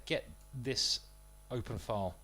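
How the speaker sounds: ordinary delivery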